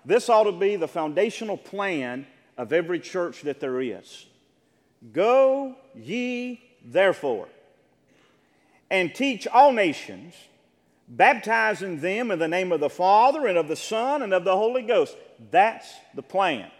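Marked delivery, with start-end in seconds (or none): 5.1-15.2 loud, raised-pitch Bible reading; Scripture proclamation. Acted. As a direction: proclaim the verse with heavy, deliberate emphasis. Reads Scripture aloud to congregation.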